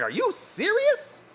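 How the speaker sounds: high, slow stunned question; high mock astonishment; imitating disbelief. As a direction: stretch out the disbelief. Friend's claim, stunned reply.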